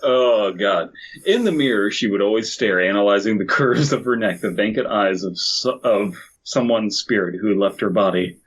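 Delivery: normal talking voice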